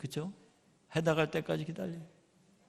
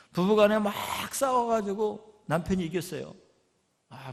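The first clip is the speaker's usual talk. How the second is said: ordinary delivery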